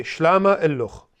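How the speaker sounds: 慢慢清楚地说 "Shlama illuch"，ll 拉长; 示范发音 "Shlama illuch"; Chaldean greeting. Shown as a demonstration. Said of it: "everyone say".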